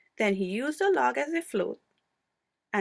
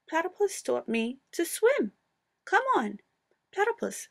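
put-on excited young Wombat; 7 semitones higher than usual. A narrator's reading.

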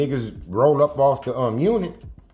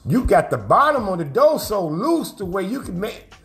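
higher, animated quote; retold exclaiming; 8 semitones higher than usual. Others marvel at his door kicking, retold high-pitched exclaiming. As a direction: imitate them exclaiming, pitched up.

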